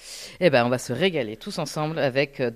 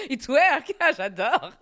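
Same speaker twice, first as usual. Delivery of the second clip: laughing; 5 semitones higher than usual. Real, not acted. Laughing at a joke.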